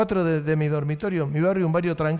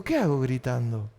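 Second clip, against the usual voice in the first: quiet self-question; 7 semitones lower, 6 dB quieter than usual. Retold or imitated. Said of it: "predicaba casi gritando".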